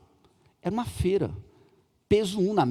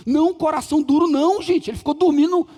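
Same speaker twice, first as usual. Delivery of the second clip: loud rebuttal; 6 semitones higher, 5 dB louder than usual. Acted. Preacher rebuts loudly.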